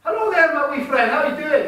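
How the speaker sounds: loud, high, hammed-up Scottish accent; Scottish greeting. Greeting the Scottish man in the crowd, loud mock Scottish accent. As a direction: boom out a cheery mock Scottish hello. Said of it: "Quite, quite Scottish there".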